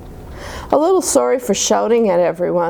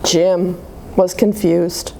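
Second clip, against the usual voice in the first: ordinary delivery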